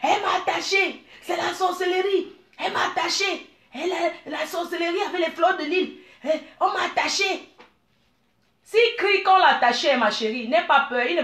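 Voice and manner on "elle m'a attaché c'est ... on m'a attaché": hammed-up villager crying witchcraft, much higher; mock terrified husband shouting he's bewitched; mock panicked cry. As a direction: squeal it like a frightened villager, half laughing. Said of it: "il va commencer à crier".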